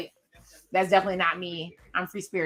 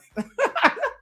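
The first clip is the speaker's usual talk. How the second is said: high laugh. Real, not acted. Laughing at her own joke.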